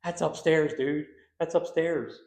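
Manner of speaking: loud, high, alarmed; retold shout. Friend scared by footsteps upstairs, loud alarmed call. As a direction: blurt it out like a spooked buddy. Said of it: "Mike's like".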